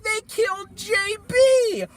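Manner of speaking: mock the crowd's indignation; outraged. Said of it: "everyone on the fucking planet would be like".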